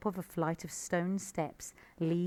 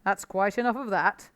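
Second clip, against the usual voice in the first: stern father fox; 3 semitones higher, 7 dB louder than usual. A narrator's reading. Father fox scolds cub.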